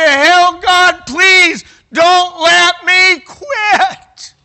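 high, very loud impassioned cry; desperate prayer. Retold or imitated. Retelling his plea to God in the stairwell, desperate shouted prayer. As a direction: plead at full force, drawing out the last words.

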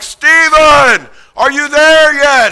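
high, loud call up the slope; man shouting up a mountain in a blizzard. Retold or imitated. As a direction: holler ahead to the climber in front, slow. Calling up the mountain.

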